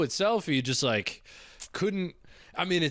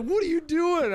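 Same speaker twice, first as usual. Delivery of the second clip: high, loud, startled dad barging in at night; suspicious dad; 3 dB louder than usual. Retold or imitated. Dad walking in at two a.m., sharp and suspicious. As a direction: catch the kid up late and demand answers. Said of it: "He'd come in, like".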